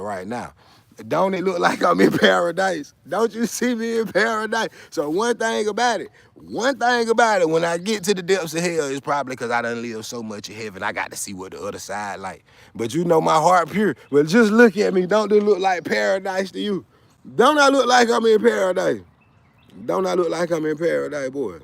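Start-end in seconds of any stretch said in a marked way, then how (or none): 1.1-8.9 high, loud and gleeful; loud bragging with a high voice; boastful excited shout. Real, not acted. Boasting loudly about paradise. 12.7-21.6 high, loud and gleeful; loud bragging with a high voice; boastful excited shout. Real, not acted. Boasting loudly about paradise.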